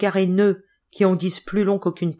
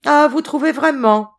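put-on high, loud sneer at the young master; mock him with a jeering lift; a touch of a sneering servant; 9 semitones higher, 6 dB louder than usual. A narrator's reading. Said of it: "ricana Hudson".

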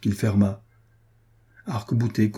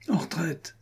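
high but softened call to retreat; king's high, restrained command; 6 semitones higher, 6 dB quieter than usual. A narrator's reading. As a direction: give the order as a held-back shout. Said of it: "commanda le roi".

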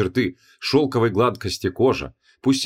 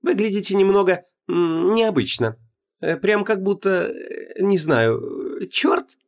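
high, slow, mock-innocent; 9 semitones higher, slower than usual. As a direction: play dumb, pitched up. Heroine mocking goddess's look.

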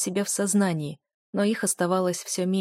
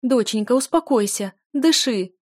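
high, loud, panicked pleading; mother's distressed, rising cries; frantic urging; 5 semitones higher, 6 dB louder than usual. A narrator's reading. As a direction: urge her to breathe, voice raised. Mother frantic over suffering daughter.

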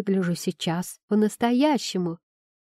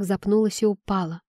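usual speaking voice

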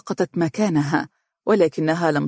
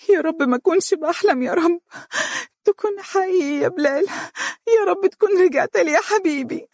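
much higher, sobbing plea to God; crying out; 14 semitones higher than usual. A narrator's reading. Wife hugging her returned husband, high sobbing cry. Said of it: "تتشممه وتبكي وتنتفض وتقول هاتفة".

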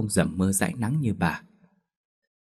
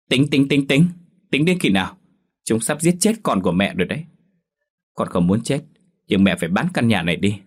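higher and louder, stamping with impatience; angry outburst; 3 semitones higher, 4 dB louder than usual. Acted. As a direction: throw a tantrum at the mother. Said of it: "dậm chân dậm cẳng nói". (con gái giận dữ gào lên với mẹ)

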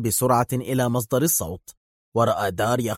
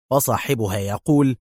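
usual speaking voice

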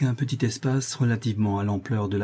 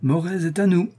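a higher, mild toast cry; exultant cheer; 6 semitones higher than usual. A narrator's reading. Officers toasting the marquis, a higher mild cheer. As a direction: lift it like a toast. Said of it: "s'écrièrent-ils en chœur".

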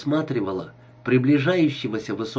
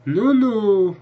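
high, louder, drawn-out amusement; amused laughing remark; 8 semitones higher, 6 dB louder than usual. A narrator's reading. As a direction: tease with a touch of laughter. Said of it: "засмеялась Берил".

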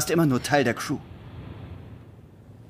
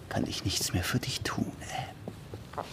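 whispered; 3 semitones lower, 10 dB quieter, slower than usual. Acted. Resignierter Abschied.

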